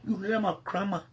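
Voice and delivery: ordinary talk, nothing marked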